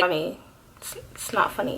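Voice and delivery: barely voiced, flat protest; whispered it's not funny; muttered deadpan. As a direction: mutter it under your breath, unamused. Mock-offended aside to grandma.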